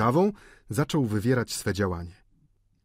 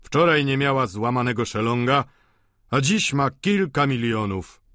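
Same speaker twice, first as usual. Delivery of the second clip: louder, put-on voice, drawing out the end; głos Vautrina; 4 semitones higher, 5 dB louder than usual. A narrator's reading. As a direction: announce it pointedly in an altered voice. Vautrin mówi do Eugeniusza. (głośniej, znacząco, zmienionym głosem)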